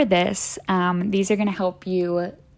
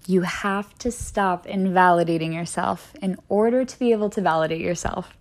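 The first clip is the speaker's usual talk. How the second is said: ordinary talk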